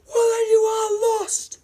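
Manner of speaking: very high, loud theatrical cry; straining at the top of the voice; anguished shout. Acted. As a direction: shout it back in hammed-up despair. Acted Obi-Wan retort in quarrel.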